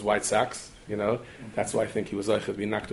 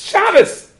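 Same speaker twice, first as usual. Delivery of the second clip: much higher, much louder single yelled word; defiant shout; 11 dB louder than usual. Retold or imitated. Man on a park bench shouting at the Rav's son, defiant. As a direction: throw the word back at him.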